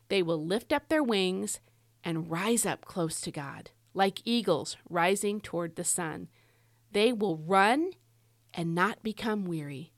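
slow scripture reading with pauses between phrases; slow, dramatic pauses. Shown as a demonstration. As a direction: let each phrase of the verse stand alone. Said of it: "I'm gonna slow down. I'm gonna get, like, a little bit of a pause".

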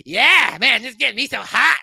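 high, cartoonish villain voice; hammed-up giddy sidekick; excited Saddam. Saddam excited beside Satan, high cartoon excitement.

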